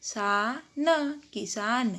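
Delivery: किसान built up very slowly; slow spelling out. Shown as a demonstration. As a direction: join the sounds slowly into किसान. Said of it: "इसमें क के छोटी की मात्रा लगाई". (धीरे धीरे जोड़कर किसान)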